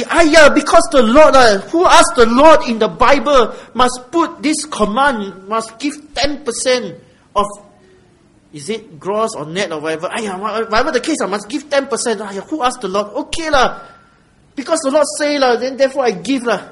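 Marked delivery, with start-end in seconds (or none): 0.0-7.5 loud, high-pitched exasperated protest; forced, grudging obligation. Acted. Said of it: "Nor of necessity". 10.1-16.6 higher-pitched exasperated giving-in; protest, then give in under duress; grudging obligation. Acted. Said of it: "Cannot be like this".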